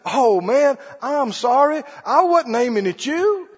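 hammed-up flustered apology; sheepish apology. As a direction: play the embarrassed shooter who didn't mean it. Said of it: "And I said".

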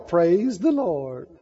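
slow, low, drawn-out mock praise; blissful believer. Onlookers mimicking a resting believer, slow blissful praise. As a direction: stretch it out in dreamy contentment. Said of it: "look at them over there going".

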